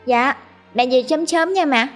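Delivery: put-on cheerful child. A narrator's reading. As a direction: answer brightly like a happy kid. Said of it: "nó vui vẻ đáp". (bé trai vui vẻ cười khúc khích)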